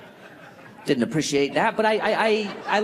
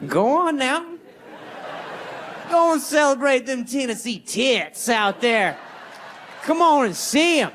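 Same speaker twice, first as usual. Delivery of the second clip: mock cheer; 7 semitones higher than usual.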